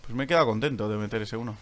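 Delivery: ordinary talk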